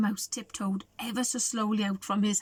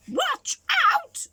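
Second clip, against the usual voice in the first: a high, sharp squawk of warning; parrot squawk. Acted. Parrot squawks a warning to the animals. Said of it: "squawked the parrot".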